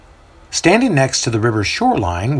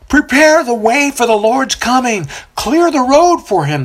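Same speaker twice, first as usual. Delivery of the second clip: much higher, louder prophet's yell; 12 semitones higher, 4 dB louder than usual. A narrator's reading. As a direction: cry it over the crowd. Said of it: "the baptizer yells out".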